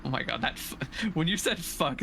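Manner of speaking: normal talking voice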